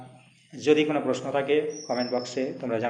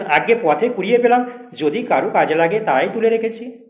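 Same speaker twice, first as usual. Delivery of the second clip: innocent excuse; 6 semitones higher, 7 dB louder than usual. Acted. Teacher voices the accused man's excuse.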